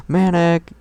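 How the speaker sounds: sharp loud shout of a name; yell. Acted. Sister shouting her brother's name in the background, loud. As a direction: bark the name across the room. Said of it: "yelled in the background".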